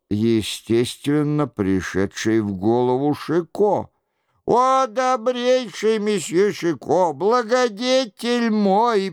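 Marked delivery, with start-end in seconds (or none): 4.4-9.1 bawling plea. A narrator's reading. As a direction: bawl pleadingly to your benefactor. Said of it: "продолжал реветь Горанфло". (жалобный высокий рёв)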